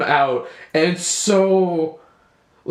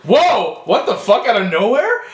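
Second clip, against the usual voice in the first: shocked disbelief; 6 semitones higher, 5 dB louder than usual. Retold or imitated. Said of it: "And I was like".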